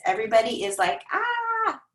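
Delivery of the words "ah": high, strangled overwhelmed "ah"; overwhelmed groan. Retold or imitated. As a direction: let out a swamped mock groan.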